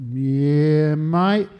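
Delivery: loud, stretched "yeah" lifting into "mate"; laconic veteran. Retold or imitated. Veteran confirming his service to a caller, loud drawled reply. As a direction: play a comic, drawn-out phone answer. Veteran's repeated phone answer, acted.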